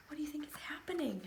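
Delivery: fast, breathy whisper after a gasp; hushed wonder to children; excited whisper. Acted. Suspense before the hatching.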